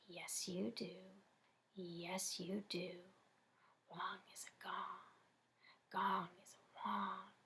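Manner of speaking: eerie whisper. Acted. Inner taunting voice.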